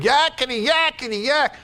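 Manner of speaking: high, fast yakety-yak chatter; squeaky mocking chatter; mock demon chatter. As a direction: mimic an annoying little demon babbling.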